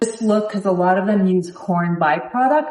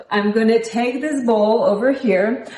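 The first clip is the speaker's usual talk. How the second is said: ordinary delivery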